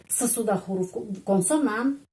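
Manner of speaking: ordinary talk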